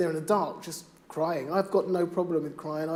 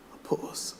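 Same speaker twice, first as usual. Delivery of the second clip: unvoiced whispered refrain; 6 dB quieter than usual. Poet closing the poem to the audience, whispered. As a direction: let the line fade to a whisper.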